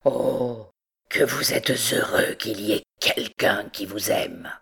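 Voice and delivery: low, strained, bitter-sweet envy; stiffened restraint. A narrator's reading. Hunchback envies the loved captain, stiffly restrained. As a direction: hold yourself stiff against the pain. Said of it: "il dit en se roidissant".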